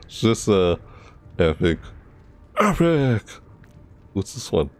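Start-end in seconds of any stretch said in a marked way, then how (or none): 2.6-3.2 excited. Real, not acted. Reacting to skin rarity.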